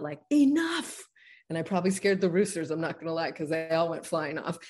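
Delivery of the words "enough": high, loud cry of enough; retold yell of enough. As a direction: shout it out to end it. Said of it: "I just yelled out".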